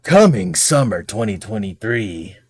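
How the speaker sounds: slow, dramatic movie-trailer announcer; epic announcer voice. Pretend. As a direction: boom it out like a trailer voice-over. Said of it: "In my epic announcer guy voice".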